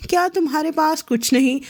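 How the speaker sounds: usual speaking voice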